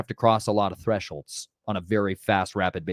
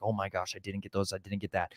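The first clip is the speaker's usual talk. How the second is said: worried mutter to self; 3 semitones lower, 12 dB quieter than usual. Retold or imitated. Retelling own worried thoughts.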